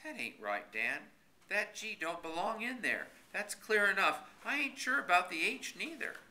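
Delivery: a hint of a stubborn country fellow; folksy old Horace voice. A narrator's reading. Horace's voice in dialogue.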